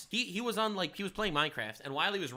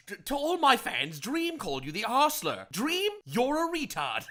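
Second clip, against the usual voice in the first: higher, slow mock British streamer voice; imitating a British streamer; 7 semitones higher, slower than usual. Mocking an edgy British streamer's shout-out, higher and slow. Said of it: "He was like".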